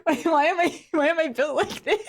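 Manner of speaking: teary, sniffly laugh breaking through the words; laughing that sounds like crying. Real, not acted. Laughing at herself about her crying-sounding laugh, sniffly and teary. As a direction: laugh till it sounds choked up. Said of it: "my laugh does sound like I'm crying".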